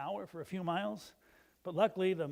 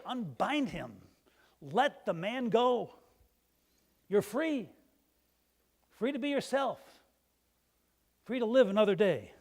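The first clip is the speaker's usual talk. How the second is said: louder, slow declaration of freedom; loud proclamation; 4 semitones higher, 7 dB louder than usual. Retold or imitated. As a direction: proclaim it to the whole church. Jesus frees Lazarus, preacher proclaims.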